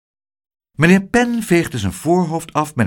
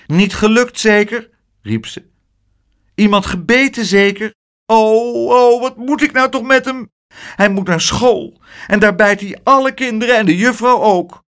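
high, loud fussing auntie; exasperated aunt; 9 semitones higher, 4 dB louder than usual. A narrator's reading. Aunt Pleeg fretting about the biting boy, high and loud. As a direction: throw up your hands at the boy.

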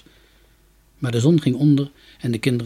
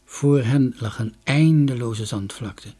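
usual speaking voice

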